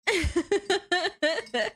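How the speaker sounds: high, rippling real laugh. Real, not acted. Streamer joking about a Boston accent, high laughter. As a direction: laugh high at your own joke.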